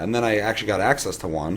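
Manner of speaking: ordinary talk, nothing marked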